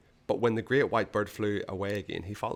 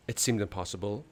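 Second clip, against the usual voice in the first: ordinary delivery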